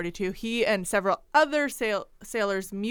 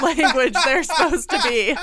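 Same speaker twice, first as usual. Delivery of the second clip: louder, higher, cracking up; laughing while talking; 5 semitones higher, 6 dB louder than usual. Real, not acted. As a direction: squeeze the words out through laughter. Cracking up over bad accents.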